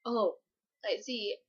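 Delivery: low, very soft reply; hushed, understated acted acceptance; resigned acceptance. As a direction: take bad news quietly, without protest. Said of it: "he just accepts it".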